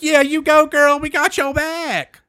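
high, loud imitation of supporters; mock cheerleading. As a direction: whoop it up like a cheering commenter. Voices cheering commenters on post.

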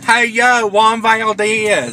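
high, loud, slow hollering of Juan Valdez; mock neighbor shouting Juan Valdez out the door; neighbor yelling a name. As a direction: call the name out across the yard, drawn out. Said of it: "That's how my na- ... neighbor sounded".

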